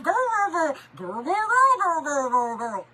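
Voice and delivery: put-on long blurred run of girls, rising then dropping; silly rattled-off girl chant; real-fast girl noise. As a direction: mimic a back-and-forth of rapid girls. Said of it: "all it is is saying 'girl' real fast".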